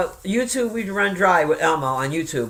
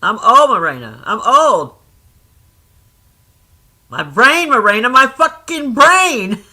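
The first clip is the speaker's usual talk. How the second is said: loud, drawn-out comic lament; exasperated self-mocking wail; 4 semitones higher, 7 dB louder, slower than usual. Real, not acted. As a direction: cry out in mock despair at yourself. Mock lament about failing memory.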